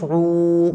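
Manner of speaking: रू with a long held ऊ from the throat; stretched ain. Shown as a demonstration. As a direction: hold the vowel of रू. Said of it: "आइन खींच के पढ़ी जाएगी।". (रू (आइन) को खींचकर)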